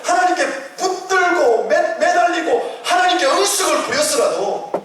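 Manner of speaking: rising pulpit fervour; preaching at full force. Acted. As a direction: preach it up high and strong. Preacher building up.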